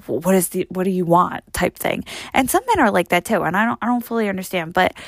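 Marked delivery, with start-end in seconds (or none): none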